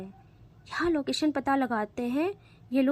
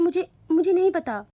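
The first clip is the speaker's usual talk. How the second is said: tearful; 6 semitones higher, 6 dB louder than usual. Acted. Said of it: "वो रोते हुए कहती है".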